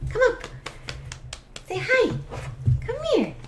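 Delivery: high sing-song call to the puppy; dog-calling voice. Real, not acted. Owner calling her puppy over, high and sing-song.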